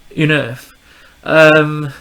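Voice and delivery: ordinary delivery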